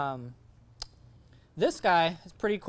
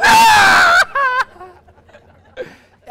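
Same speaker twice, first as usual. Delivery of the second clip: high, loud laughing shout; real laughing yelp; loud burst of laughter; 20 dB louder than usual. Real, not acted. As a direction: crack up loudly. Laughing hard at the memory.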